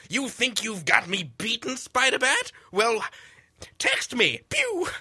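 high, sing-song comic villain gloat; hammed-up villain taunt. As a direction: gloat at Spider-Bat like a cheesy supervillain. Doctor Valentine taunting Spider-Bat.

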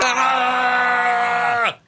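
long, high, loud comic scream; drawn-out goofy shriek; Pee Wee Herman scream. Pretend. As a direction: hold one high screamed note. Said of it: "more of a Pee Wee Herman scream".

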